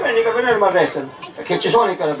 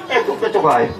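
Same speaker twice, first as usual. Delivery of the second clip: usual speaking voice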